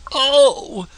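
high little wail; a small high cry; gingerbread man voice. A narrator's reading. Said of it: "said the gingerbread man".

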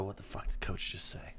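barely voiced aside between players; furtive whisper. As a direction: whisper it in mock bafflement. Players muttering to each other.

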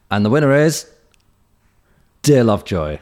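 loud, high winner reveal with rising pause; hyped award announcement. Pretend. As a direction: build up then announce the winner. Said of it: "we'll do it again".

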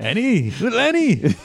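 high, laughing character call; Watto. Acted. Teasing co-host who keeps bringing up the character, high laughing impression. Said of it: "Again, with the Watto".

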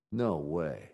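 usual speaking voice

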